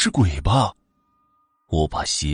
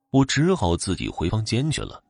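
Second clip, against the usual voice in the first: plain reading voice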